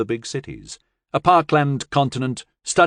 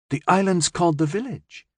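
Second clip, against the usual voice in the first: put-on puzzled, rising child's question; puzzled girl questioning the name; 7 semitones higher than usual. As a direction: ask it in disbelief. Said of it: "Jay asked in puzzlement".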